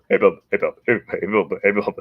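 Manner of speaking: loud, gruff repeated hey bub, pushed out; hammed-up walrus voice. Walrus and beaver quote redone bigger, loud gruff hey bub. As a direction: bark it like a walrus at his buddy. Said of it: "I hope I can do this scene justice".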